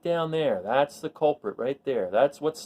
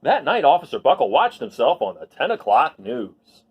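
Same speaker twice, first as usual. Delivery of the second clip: lively storybook read-aloud; reading to children; 4 semitones higher than usual. A narrator's reading. Teacher reads a picture book to the class, lively storybook voice. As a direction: tell the story to a class of kids.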